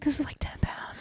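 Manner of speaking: whispered aside about the heavy award. Retold or imitated. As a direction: whisper it as if only to yourself.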